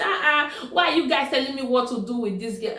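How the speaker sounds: a woman snapping back at neighbours who tell her what to do; mock angry protest. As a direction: fire back at them, offended and quick. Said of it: "So the woman get angry".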